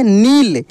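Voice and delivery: नील with the ī stretched, rising then falling; drawn-out long ī. Shown as a demonstration. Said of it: "इसका उच्चारण खींच कर होता है". (नील की ई खींच कर)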